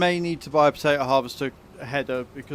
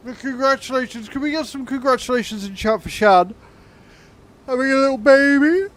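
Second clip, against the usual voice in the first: high, loud, excited cheer; excited congratulations; 9 semitones higher, 7 dB louder than usual. Real, not acted. Congratulating viewer on baby.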